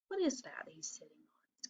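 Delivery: secret thinking whisper. Pretend. Teacher whispering to children while hunting a rhyme. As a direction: whisper it like a puzzle.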